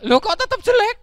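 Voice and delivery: very high, loud whining protest; squealing, indignant donkey; upset disbelief. Acted. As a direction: wail in baffled frustration. Donkey upset voice still ugly.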